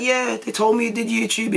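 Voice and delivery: light Newcastle impression; imitated Tyneside accent. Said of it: "in his New-, Newcastle Ty-, Tyneside accent".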